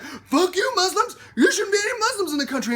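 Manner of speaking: high, loud mock heel rant; Donald Trump. Candidate taunts the public like a heel, shouted mockingly. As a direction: shout it as a hammed-up villain. Imitating candidate as wrestling villain.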